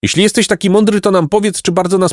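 put-on high-pitched boy's voice, urgent question; high, anxious child; pleading boy. A narrator's reading. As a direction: press the raven for an answer, pitched high. Boy anxiously challenges the raven.

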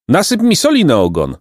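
high taunting bird voice; mocking woodpecker. Woodpecker jeering at the boys as it leaves, high teasing. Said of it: "zaśmiał się dzięcioł już z daleka".

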